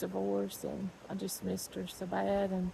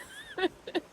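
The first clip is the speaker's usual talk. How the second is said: short laugh; 11 semitones higher than usual. Real, not acted. Laughs at own remark.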